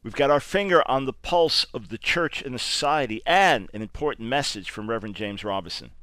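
usual speaking voice

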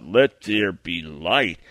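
low, very slow sneering echo of the proclamation; mocking enemy voice. Preacher's enemy throwing his words back at him, low slow mockery. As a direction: mimic the words with scorn. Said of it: "the enemy's in my ear saying".

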